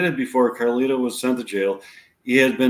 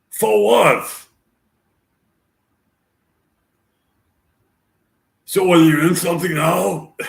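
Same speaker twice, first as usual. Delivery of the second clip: Al Pacino impression; 7 semitones higher, 6 dB louder than usual. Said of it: "Doesn't sound at all like Al Pacino".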